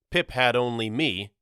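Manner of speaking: ordinary delivery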